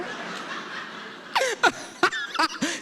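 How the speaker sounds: helpless laugh. Real, not acted. Laughter at the memory.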